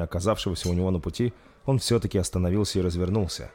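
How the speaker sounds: usual speaking voice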